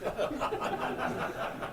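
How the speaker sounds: a soft, airy chuckle; a warm breathy chuckle; breathy real laugh. Real, not acted. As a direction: laugh quietly at your own joke. Laughs at his own joke.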